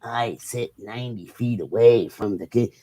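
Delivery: a low, lightly voiced Nicholson line; Jack Nicholson. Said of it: "Jack Nicholson ... like he said".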